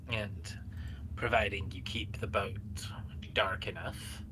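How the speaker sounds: hushed secretive plan; smuggler's whisper. Acted.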